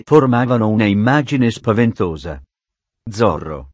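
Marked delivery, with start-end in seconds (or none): 3.1-3.7 Zorro croaked low and strangled; a startled, croaking cry. Acted. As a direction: sergeant croaks in fright at the charging horse. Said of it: "gracchiò Garcia".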